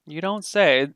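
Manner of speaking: lightly voiced folksy local, lifting in pitch; curious local. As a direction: question the strangers with growing curiosity. Game master plays a local.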